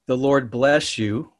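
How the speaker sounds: slow, deliberate blessing with bless held; speaking a blessing slowly over friends; slow reading. Shown as a demonstration. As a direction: read it slow and solemn, each word weighed. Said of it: "I'm gonna read it slow".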